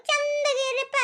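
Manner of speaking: high, long-drawn wailing; martyred, sobbing-style farewell; tearful wail. Acted. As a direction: stretch it out in a plaintive high cry. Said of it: "ಅವು ಎದಕ್ ಅಳಾಕತ್ತಾಳ".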